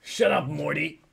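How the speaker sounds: brusque mad-scientist voice; Rick. Acted. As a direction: cut the kid off in character. Imitating Rick to Morty.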